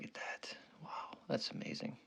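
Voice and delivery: breathy whispered awe. Real, not acted. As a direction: whisper as if not to disturb it. Admiring robot pig image.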